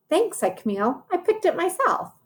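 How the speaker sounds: put-on high little girl voice; high, proud child voice; Camille proud. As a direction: chirp it proudly like a small child.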